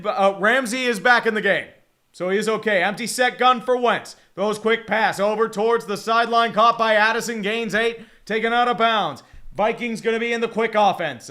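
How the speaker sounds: brisk, projected football call; live play-by-play. Real, not acted. Streamer calling a quick sideline pass, projected play-by-play. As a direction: call each move as it unfolds.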